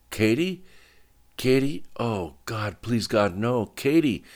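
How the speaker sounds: high, desperate calling out; panicked shouting. A narrator's reading. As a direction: call her name in rising dread. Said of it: "shouted Dick".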